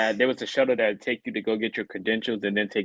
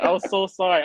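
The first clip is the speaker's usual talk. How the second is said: laughing; 7 semitones higher than usual. Retold or imitated. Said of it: "And she's laughing".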